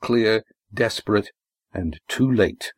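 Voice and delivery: usual speaking voice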